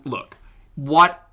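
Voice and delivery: ordinary delivery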